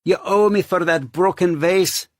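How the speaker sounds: high, loud scolding landlady; scolding older woman. Acted. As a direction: scold him over the damage. Landlady scolds over broken vase.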